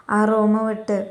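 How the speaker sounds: ordinary delivery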